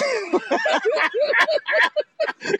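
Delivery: very high, breaking bursts of cackling; high laughing squawks. Real, not acted. Storyteller amused by the two crows, shrieking high cackle. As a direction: crack up in crow-like shrieks. Cracks up over own crow story.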